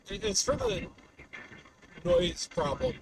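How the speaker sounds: usual speaking voice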